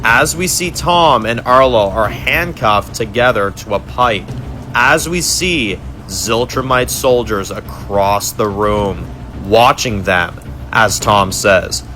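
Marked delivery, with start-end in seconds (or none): none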